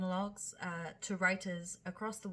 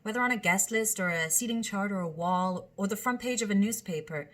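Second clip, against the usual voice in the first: louder, steady dramatic reading; monologue reading; 8 dB louder than usual. Acted. Host reads a monologue aloud, a projected performance. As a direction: read each line out to an audience.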